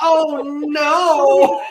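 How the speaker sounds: high, loud, drawn-out wail; hammed-up cry of dread; mock freak-out. Player realising the finals are days away, mock-wailing.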